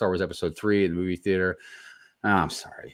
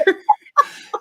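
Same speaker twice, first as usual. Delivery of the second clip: high laugh; 9 dB louder than usual. Real, not acted. Laughing at own voice.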